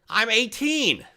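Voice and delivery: put-on young guy. Young adult answering.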